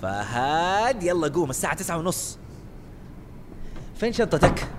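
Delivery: wake-up call. Acted. Waking friend, calling out.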